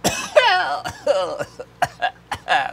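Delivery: coughing fit after swallowing wrong; swallowed wrong way. Real, not acted. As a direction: cough out a drink gone down wrong. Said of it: "almost went down the wrong way".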